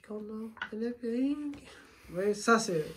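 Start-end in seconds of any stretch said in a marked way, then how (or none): 0.1-1.6 mock voice of Reda. Shown as a demonstration. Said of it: "ne le faites pas, Reda".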